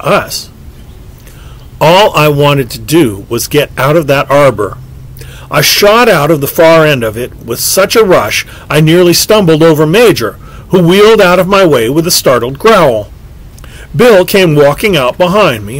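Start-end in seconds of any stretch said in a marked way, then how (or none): none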